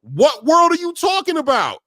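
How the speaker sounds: loud, slow, high disbelieving shout. Real, not acted. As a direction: yell it in disbelief.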